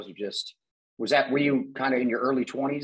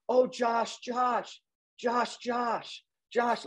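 high sing-out of the name Josh; mock adoring, pitched well up; imitating gushing friend; 10 semitones higher, much slower than usual. Host mimics Bradford raving about Josh, gushing. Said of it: "all I heard about you was from Bradford".

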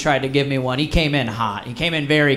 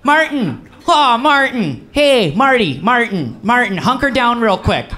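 Uncle Jim; 5 semitones higher than usual. Retold or imitated. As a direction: call out Martin over and over like a pushy Midwestern uncle. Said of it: "he's just like".

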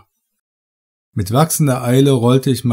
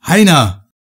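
higher, louder call of a name; 5 semitones higher, 5 dB louder than usual. Acted. Narrator calls for his vanished companion, loud call. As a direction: call him into the dark. Calling into the red light.

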